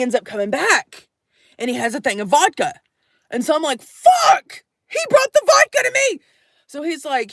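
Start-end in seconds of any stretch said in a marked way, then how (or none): 3.8-6.2 high, loud panicked yelp; a sudden loud squeal of panic at the gift; panicked outburst. Retold or imitated. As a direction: blurt it out in alarm. Panicked reaction to vodka gift.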